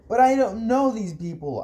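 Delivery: high, frustrated protest; moderately loud, high-pitched outburst; angry shout. Acted. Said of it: "I shouted".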